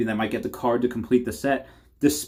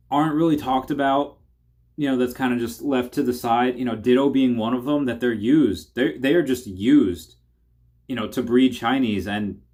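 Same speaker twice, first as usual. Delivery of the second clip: ordinary talk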